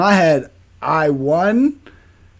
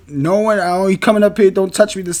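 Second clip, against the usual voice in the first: usual speaking voice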